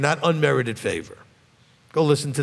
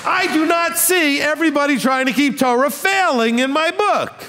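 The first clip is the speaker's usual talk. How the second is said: preaching at full force; 9 semitones higher than usual. Acted.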